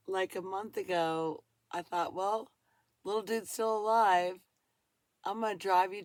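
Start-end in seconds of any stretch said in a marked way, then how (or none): none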